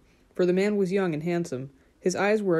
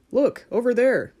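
loud, high call of discovery; shout; 6 semitones higher, 6 dB louder than usual. A narrator's reading. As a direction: call out sharply to the others. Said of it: "Marian heard someone shout".

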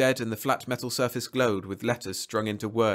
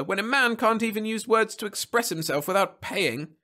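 high, sustained indignant rant; raving; 12 semitones higher than usual. A narrator's reading. Man outraged at paying for words, raving. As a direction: rave on without letting up. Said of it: "raved the other".

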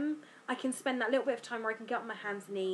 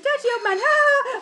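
put-on much higher, louder childish chortle; kids chortling; 14 semitones higher, 11 dB louder than usual. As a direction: copy the kids cackling the phrase. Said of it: "Alice and George both went".

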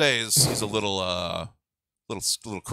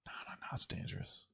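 softer, whispered warning; mock whisper; 12 dB quieter than usual. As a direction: hush it like a secret. Joking hush about dangerous driving.

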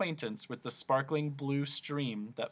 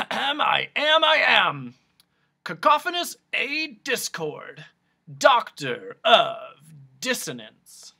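loud, high proud proclamation slowing to a grand finish; roaring; 6 semitones higher, 9 dB louder, slower than usual. A narrator's reading. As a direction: roar out name and title grandly. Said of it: "roared the man".

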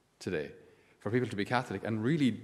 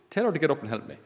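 higher, louder fed-up demand; exasperated Martha; 6 semitones higher, 6 dB louder, faster than usual. Acted. Martha complains to Jesus about Mary, higher and louder. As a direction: snap it like an overworked sister.

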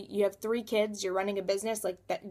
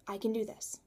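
much softer, almost to herself; quiet repeat; 8 dB quieter than usual. Retold or imitated. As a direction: let it fall to a private murmur. Self-talk, second take.

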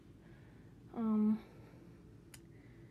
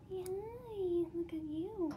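much higher, soft coaxing voice to the bird; high pet talk; 8 semitones higher, 4 dB quieter, slower than usual. Real, not acted. Girl cooing softly at her pet bird, much higher.

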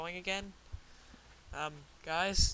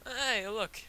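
normal talking voice

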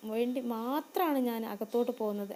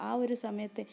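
ordinary delivery

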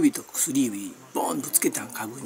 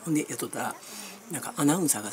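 ordinary talk, nothing marked

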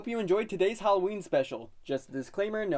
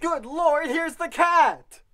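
higher, louder, dragged-out shock; startled exclaim; 6 semitones higher, 6 dB louder, slower than usual. Acted. Boy spotting the cat, high slow shock. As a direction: stretch the words in alarm.

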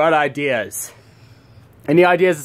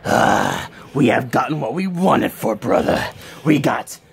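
low villain voice; 4 semitones lower, slower than usual. Acted. Villain in lower voice.

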